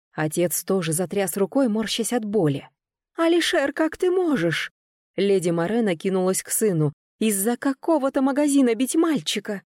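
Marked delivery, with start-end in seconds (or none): 3.2-4.7 shocked outcry. A narrator's reading. Mother shocked as son is hit. 7.2-9.6 choking on outrage; a high indignant mother's protest; indignant outcry. A narrator's reading. Mother defends her son from his father, outraged. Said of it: "Она захлебнулась от возмущения".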